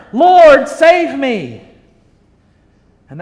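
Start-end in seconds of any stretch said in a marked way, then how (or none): 0.1-1.6 loud, higher desperate plea; crying out. Acted. Preacher voices sinking Peter calling out to Lord. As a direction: shout it like you're going under. Peter's cry retold again.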